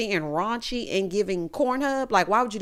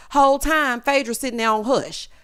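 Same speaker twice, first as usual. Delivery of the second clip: ordinary talk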